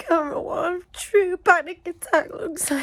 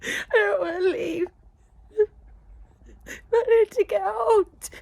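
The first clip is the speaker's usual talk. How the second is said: panicked crying; 7 semitones higher than usual. Real, not acted. Filmed during a real panic attack.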